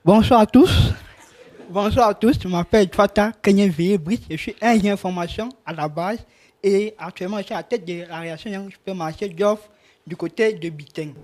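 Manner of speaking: talking around a pen held in the teeth; pen in mouth. Shown as a demonstration. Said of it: "avec le stylo dans la bouche".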